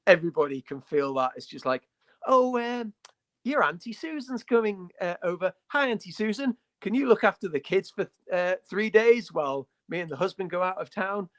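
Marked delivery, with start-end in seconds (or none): 2.3-11.3 high-pitched parent asking a favour; lightly acted parent buttering up a babysitter; chirpy parent. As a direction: put on a higher, sweet-talking parent voice. Voicing parent asking Auntie Susan.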